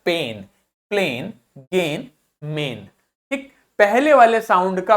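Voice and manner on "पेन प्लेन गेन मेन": पेन, प्लेन, गेन, मेन with a held "ay"; word by word, long A in प्लेन and गेन; long first vowel. Shown as a demonstration. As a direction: stretch the "ay" in पेन through मेन. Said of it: "पहले वाले vowel का long sound आया".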